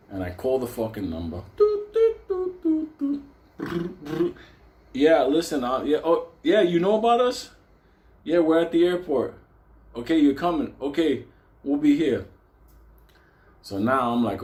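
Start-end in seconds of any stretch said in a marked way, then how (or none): 1.6-4.4 very high, short phone beeps; imitated dial tones. Imitates dialing the payphone. 4.9-12.3 raised-pitch, louder payphone talk; acted phone call.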